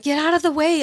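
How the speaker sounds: high, loud retold shout to clear a path; urgent yell. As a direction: yell it at people blocking you. Yelling at people blocking her.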